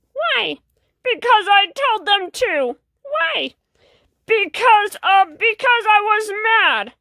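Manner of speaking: put-on high, childlike little voice asking why; a curious little kid nagging with why; small child asking why. Little kid pestering the sad fish, high and insistent. Li'l Petey asks why repeatedly.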